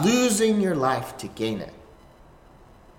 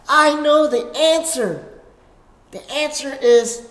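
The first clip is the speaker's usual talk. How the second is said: eager pupil; 7 semitones higher, 4 dB louder than usual. Acted. Peter eagerly answers like a pupil.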